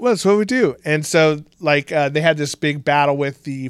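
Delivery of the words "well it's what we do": high, matter-of-fact shrug; casual shrug. Retold or imitated. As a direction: say it like it's obvious. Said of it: "He's like".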